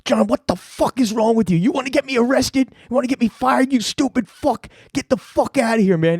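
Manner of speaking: high, strained angry rant; retold furious tirade. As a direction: act out a shaken boss chewing someone out.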